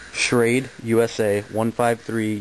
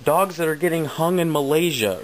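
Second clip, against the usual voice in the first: imitating the seller; 7 semitones higher than usual. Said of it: "She's like".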